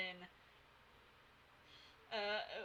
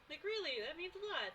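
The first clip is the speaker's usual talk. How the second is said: ordinary talk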